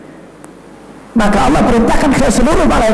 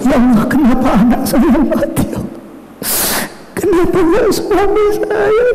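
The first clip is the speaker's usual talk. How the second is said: fast, tearful imitated pleading; hammed-up weeping mother's prayer; crying prayer; 8 semitones higher than usual. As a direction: wail at God through mock tears.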